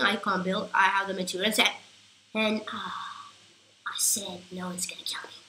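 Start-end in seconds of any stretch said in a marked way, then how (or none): 2.3-5.4 hushed whisper while hiding. Real, not acted. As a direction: keep it to a whisper so nobody hears. Hiding, lying low whispering.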